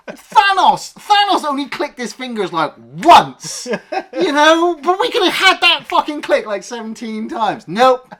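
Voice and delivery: loud, high-pitched exasperated outburst; incredulous rant. Real, not acted. Ranting that Thanos clicked only once, incredulous shout. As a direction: shout it in outraged disbelief.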